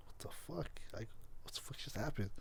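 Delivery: whispered, stunned mock reaction; stunned retold reaction. Imitating a kid who just got cursed at, stunned whisper. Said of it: "just took that like".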